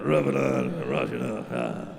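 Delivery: very low gruff ad voice; deep macho ad voice. Pretend. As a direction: growl it like a soap commercial.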